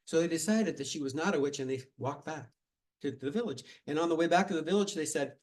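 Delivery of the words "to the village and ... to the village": ordinary delivery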